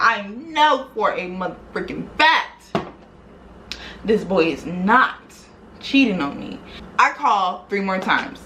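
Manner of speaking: usual speaking voice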